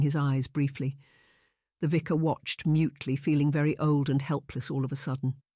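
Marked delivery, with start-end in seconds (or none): none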